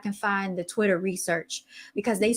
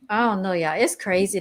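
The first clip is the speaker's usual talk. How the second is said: normal talking voice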